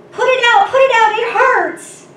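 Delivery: loud, high cry of pain and panic; fearful shriek. A narrator's reading. Cave people recoiling from the sudden flames, shrieking in fear. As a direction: shriek it out, desperate, far above normal pitch. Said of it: "the people shrieked and they turned away in fear".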